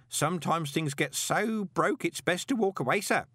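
high-pitched gossipy underling; lively, high character voice; chatty old postman Grote. Acted. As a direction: relay grim gossip with relish, pitched well up.

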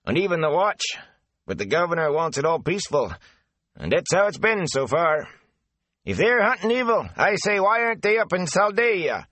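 a garrulous country man passing on rumours; chatty rustic gatekeeper. Acted. Avin continues gossip.

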